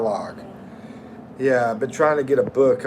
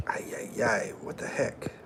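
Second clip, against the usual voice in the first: whisper; 4 semitones lower, 11 dB quieter than usual. Real, not acted. Surprised by a donation.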